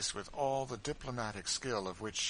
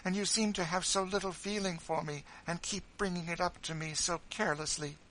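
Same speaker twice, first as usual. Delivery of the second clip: higher and louder, a whiny mock-tearful complaint; self-pitying sobbing; 6 semitones higher, 5 dB louder than usual. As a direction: wail about your trials to win pity. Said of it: "began sobbing".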